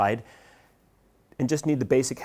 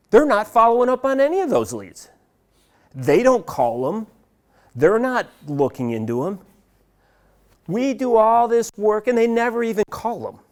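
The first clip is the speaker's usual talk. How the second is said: indignant complaint; 6 semitones higher than usual. Retold or imitated. Marketing voiced complaining about sales.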